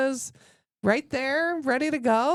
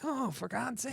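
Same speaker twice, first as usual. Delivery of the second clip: much softer, under the breath; muttered exasperation; 3 semitones higher, 10 dB quieter than usual. Retold or imitated. Aunt dreading the snake, soft exasperated aside. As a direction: grumble to yourself in dread. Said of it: "And I'm like".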